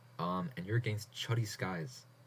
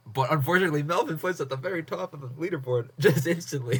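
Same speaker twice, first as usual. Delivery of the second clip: louder and higher, laughing in shock; startled outburst; 6 semitones higher, 7 dB louder than usual. Real, not acted. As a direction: blurt it out, caught off guard. His entry beaten, startled.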